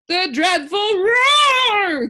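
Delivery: very high, loud, slow wail; anguished cry. A narrator's reading. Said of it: "cried the anguished din".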